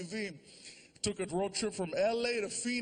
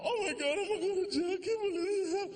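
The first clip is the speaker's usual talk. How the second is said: much higher panicky wail; panic, close to tears; freaking out; 11 semitones higher than usual. Retold or imitated. Driver pulled over by police, a high panicky wail. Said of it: "freaking out".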